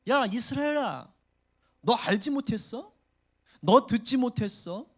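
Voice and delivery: loud, slow, pointed questions; challenging retort. Acted. Isaiah challenges Israel, loud and slow. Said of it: "반문하고 있는 거예요".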